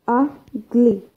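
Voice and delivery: "ugly" with UG stressed, split from -ly; stress on first syllable. Shown as a demonstration. Teacher giving adjective examples, first-syllable stress on "ugly". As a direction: punch the UG in "ugly". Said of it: "adjective first syllable".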